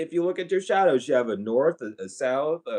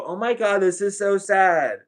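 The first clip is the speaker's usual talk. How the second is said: mock sympathy; 5 semitones higher, 4 dB louder, slower than usual. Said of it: "You go".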